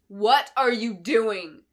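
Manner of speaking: slow, loud yell; loud drawn-out furious yell. Acted. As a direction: bellow the question across the room. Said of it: "yelled Clarice".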